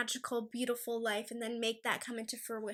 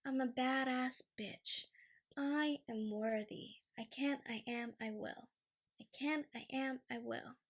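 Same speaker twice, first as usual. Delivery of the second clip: meditative affirmations; 6 dB quieter than usual. Real, not acted. Frustrated vlogger calming herself with affirmations, hushed meditative delivery. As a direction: murmur each affirmation with pauses, sinking softer.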